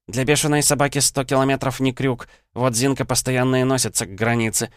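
a stern nurse dressing down a drinker; scolding nurse. Acted. Tatyana scolds Slavik.

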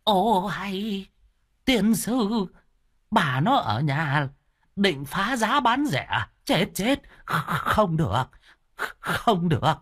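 put-on high, alarmed old man's voice; drawn-out, high-pitched old-timer's dismay; startled deaf elder. A narrator's reading. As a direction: protest in a fluster. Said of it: "Ông cụ Luân điếc giật mình đứng phát dậy".

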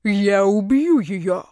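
slow, heavy threat from the villain; menacing ruler voice. Acted.

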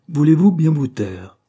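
loud, slow, furious cry to be silent; angry shout. A narrator's reading. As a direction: stamp and shout him down. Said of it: "cria-t-elle en frappant du pied".

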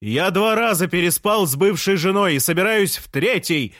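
high-pitched, brazen retort; defiant shout. A narrator's reading. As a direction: shout it back for all to hear. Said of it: "закричал Жан".

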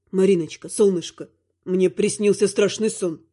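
low, tender dark lord; deep male voice, frightened husband. Acted. (низкий голос тёмного властелина)

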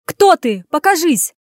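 loud demanding yell. Acted. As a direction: shout at the hidden man. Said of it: "завопила Анюта".

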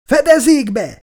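high, loud cry to take cover; a sudden, piercing order to take cover; shouted warning. A narrator's reading. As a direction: yell it as the beast lunges. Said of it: "kiáltott fel Sebastian".

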